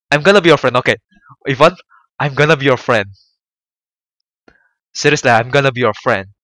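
loud earnest promise. Real, not acted. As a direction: declare it loudly, insisting. Promising friendship to Ivan.

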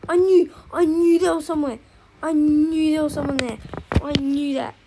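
loud, exasperated yell; frustrated shout. Real, not acted. Streamer yelling after dying to a hidden enemy, exasperated. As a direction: vent the loss at full volume.

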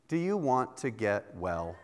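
soft, quiet, half-laughing question; a hint of a gently teasing wife; wife asking gently. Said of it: "come up to me while I'm sick and said".

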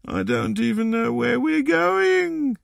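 put-on high, slow tearful little boy; drawn-out, high-pitched childish whine; tearful sniffing. A narrator's reading. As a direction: wail it slowly like a crying child. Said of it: "sniffed the boy".

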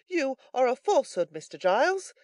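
high, loud retort; indignant Brittles. A narrator's reading. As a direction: fling the accusation back in a high voice.

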